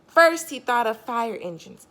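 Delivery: usual speaking voice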